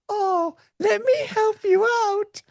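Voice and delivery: high, slow, sultry-sweet female assistant voice; mock female AI voice. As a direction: play a flirty computer lady offering help. Female AI voice imagined.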